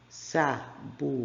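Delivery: "Sabugosa" split into separate syllables, ending in a Z sound. Shown as a demonstration. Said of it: "que o som é Z".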